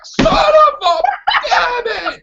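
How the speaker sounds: very high, loud outburst; comically exasperated shriek; mock furious cursing. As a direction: replay an outraged curse at full pitch. Re-enacting reaction to the spoiler again.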